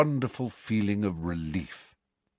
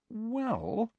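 tentative; 6 dB quieter than usual. Acted. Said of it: "said Hermione tentatively".

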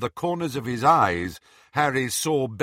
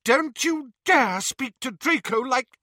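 high, louder furious mother; 5 dB louder than usual. Acted. Narcissa to Bellatrix, high furious voice. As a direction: defend her son in fury. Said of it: "said Narcissa furiously".

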